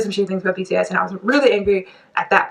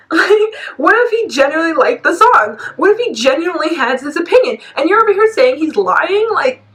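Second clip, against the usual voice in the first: high, louder, incredulous argument; indignant challenge; 9 semitones higher, 4 dB louder than usual. Real, not acted. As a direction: challenge them in outraged disbelief. Arguing with the absent podcast hosts.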